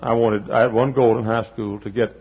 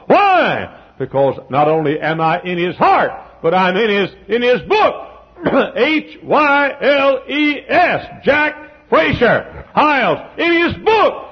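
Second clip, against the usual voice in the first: high, loud preacher climb; preaching at full force; 12 semitones higher, 6 dB louder, slower than usual. Real, not acted. Preacher lifting the congregation, rising and ringing. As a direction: build the sermon to a ringing peak. Preacher's climax on his name.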